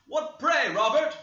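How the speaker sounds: high, loud call across the house; hallooing from the entrance. Acted. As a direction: call out sharply to the servant. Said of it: "hallooed Linton from the entrance".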